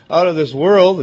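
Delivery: ordinary delivery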